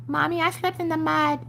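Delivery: put-on high, small child's voice; little girl voice. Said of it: "she's like".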